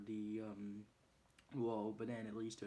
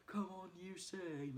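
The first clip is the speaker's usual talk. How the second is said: mock call; 8 semitones higher, slower than usual. Bunny running after a thief, mock calling out. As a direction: imitate calling after someone.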